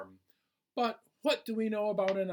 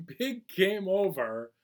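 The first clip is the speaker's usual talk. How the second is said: ordinary delivery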